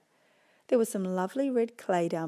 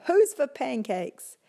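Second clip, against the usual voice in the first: excited call; 6 semitones higher, 6 dB louder, slower than usual. Acted. Aurora calling out.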